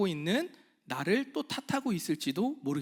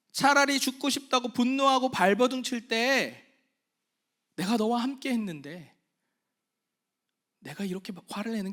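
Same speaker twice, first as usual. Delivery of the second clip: fervent sermon climax fading at the end; impassioned preaching; 7 semitones higher, 5 dB louder than usual. Acted. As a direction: preach it with full force, building.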